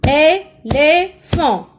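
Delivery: éléphant loud and slow, é - lé - phant; syllable by syllable. Shown as a demonstration. Teacher claps the word for pupils, loud separate syllables in éléphant. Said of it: "Combien de fois je frappe".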